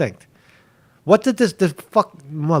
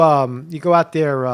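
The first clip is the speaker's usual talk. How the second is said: ordinary delivery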